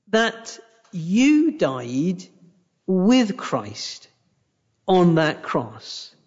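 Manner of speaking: slow, weighty, each phrase set apart; slow solemn emphasis; slow emphatic. Acted. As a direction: lay it down slowly, word by word. Preacher slows key phrase.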